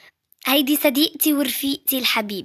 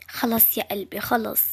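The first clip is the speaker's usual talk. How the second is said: soothing; 4 semitones lower, 6 dB quieter than usual. Acted. Comforting a crying girl.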